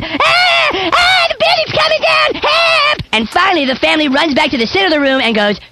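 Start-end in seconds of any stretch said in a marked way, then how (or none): 0.0-3.0 high panicked mock screams; mock screaming. Family in the joke screaming as the building falls, high mock panic. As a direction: shriek it like people fleeing a collapse.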